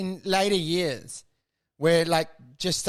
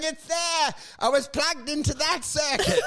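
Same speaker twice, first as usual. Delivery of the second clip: hysterical yelling; 9 semitones higher than usual. Retold or imitated. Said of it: "it's yelling hysterically".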